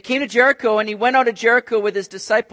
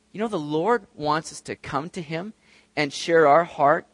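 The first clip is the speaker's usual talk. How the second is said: ordinary delivery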